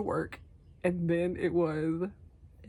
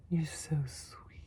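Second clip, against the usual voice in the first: tender cooing; much slower than usual. Real, not acted. Cooing to her pet.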